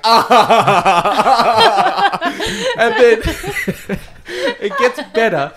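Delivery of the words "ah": loud laughing grunt, high; a hammed-up Arnie lifting his daughter; mock Arnie grunt. Arnie grunts as he lifts his daughter, mock loud grunt. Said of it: "he's like".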